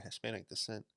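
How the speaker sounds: ordinary delivery, nothing marked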